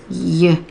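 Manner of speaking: "Y-R-D" as separate sounds yuh, ar, duh with long pauses; segmented sounds. Shown as a demonstration. Teacher sounding out yard while writing, segmented. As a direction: sound out "Y-R-D" one sound at a time, not letter names. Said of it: "have them finger spell".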